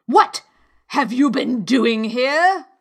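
cross royal interrogation; queen's demand. A narrator's reading. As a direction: demand an answer imperiously. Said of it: "she went on".

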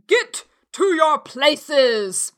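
booming, stretched-out queen's command; thunderous shout. A narrator's reading. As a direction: bellow it like thunder. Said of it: "in a voice of thunder".

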